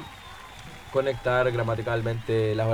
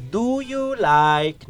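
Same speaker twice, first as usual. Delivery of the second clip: very slow, separated "Do... you... like?", pitched high; unconnected words; 9 semitones higher, much slower than usual. Shown as a demonstration. Teacher showing how children say it, slow separated words. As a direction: pull each word apart in "Do you like?". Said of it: "cuando los niños dicen".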